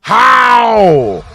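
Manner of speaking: loud incredulous yell; furious how; angry shout. Real, not acted. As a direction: shout it in disbelief. Yelling at the clip.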